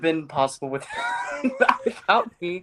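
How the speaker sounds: laughing while tickled; squirming, breaking into laughs; being tickled. Real, not acted. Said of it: "Ben, stop tickling him".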